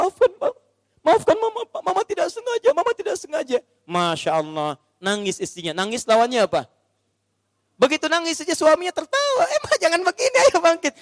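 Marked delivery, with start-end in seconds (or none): 0.0-3.6 high, pleading apology from a tearful wife; crying wife's apology. Acted. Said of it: "menangis di kaki suaminya". 9.5-10.9 laughing husband. Acted. Husband comforts crying wife.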